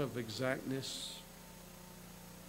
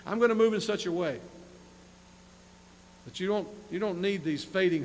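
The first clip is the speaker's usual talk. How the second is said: higher, louder paraphrase as God speaking; God's voice; 6 semitones higher, 6 dB louder than usual. Acted. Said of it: "That's the emphasis here".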